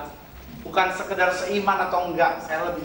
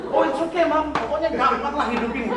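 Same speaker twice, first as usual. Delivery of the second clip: mock carefree spender; 8 semitones higher than usual. Imitating carefree spender at home.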